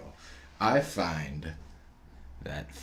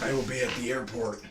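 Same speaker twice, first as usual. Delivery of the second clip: higher, loose [name] imitation; [name] impression; 5 semitones higher than usual. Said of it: "this is my [name] impression".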